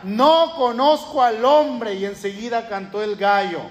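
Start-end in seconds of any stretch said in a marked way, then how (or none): 0.0-1.9 slow, higher, emphatic denial, leaning on no; Peter cursing and swearing. Retold or imitated. Preacher voicing Peter swearing he doesn't know Jesus, slow and high. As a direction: swear it off slowly, pitched up. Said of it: "comenzó a maldecir y a jurar".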